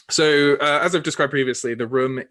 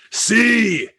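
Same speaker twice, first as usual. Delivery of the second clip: louder, higher boisterous praise; roaring with laughter; 4 semitones higher, 7 dB louder than usual. Acted. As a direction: boom it out, delighted with him. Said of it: "roars in laughter again and says".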